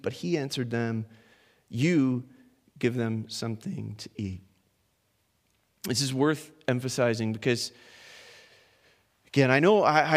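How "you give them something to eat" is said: "You" set apart by a pause, then low and quiet; stress on You. Retold or imitated. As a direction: land on "You", pause, then finish softly. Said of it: "there's this emphatic".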